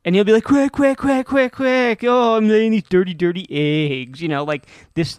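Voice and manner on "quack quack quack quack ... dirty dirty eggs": loud quacking into a tough-guy duck boast, settling by the end; silly duck with a Duke Nukem voice. Acted. Podcaster demonstrates his co-host's duck character, silly Duke Nukem duck. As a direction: quack it high and loud, then slow down on the boast. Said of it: "this silly duck with the Duke Nukem voice".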